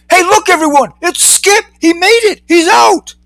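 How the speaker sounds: very high, thrilled crowd cries; excited cheering. A narrator's reading. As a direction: cheer the news to everyone. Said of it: "A cheer erupted from inside".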